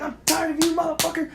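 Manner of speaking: high re-enacted outburst; pent-up anger. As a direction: let built-up rage burst out. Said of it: "pent-up aggression and that pent-up anger".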